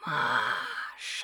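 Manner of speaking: soft, distant voice calling; faint call. Acted. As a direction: call her name from far away. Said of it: "услышала она более отчётливо".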